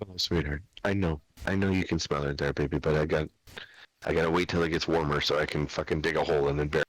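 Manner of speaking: low, gentle soothing; soothing pet talk. Real, not acted. Soothing the dog again.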